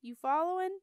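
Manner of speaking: loud, high call over the water. A narrator's reading. Bogart calling to the canoes from the water, loud and high. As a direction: call back to the canoes behind. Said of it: "calling out".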